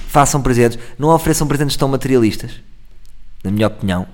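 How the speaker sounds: ordinary delivery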